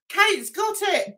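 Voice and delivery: normal talking voice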